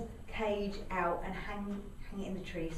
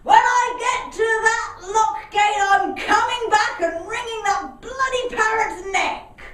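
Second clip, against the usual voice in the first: angry boatman; 11 semitones higher, 14 dB louder than usual. Retold or imitated. Boatman chasing his boat threatens the parrot, loud and angry. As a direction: bellow it after the runaway boat. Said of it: "One turned round and said".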